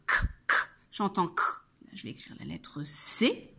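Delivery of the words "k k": "K, K" as bare voiceless clicks of sound; isolated phoneme. Shown as a demonstration. Teacher making pupils hear the phoneme, "K" sounded alone. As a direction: say "K" as only the consonant, no vowel. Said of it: "il fait le phonème K".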